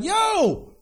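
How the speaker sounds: high excited call of yo. Retold or imitated. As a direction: call out yo with sudden joy. Abraham greets God at tent.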